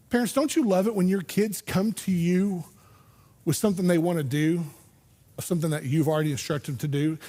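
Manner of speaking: ordinary talk, nothing marked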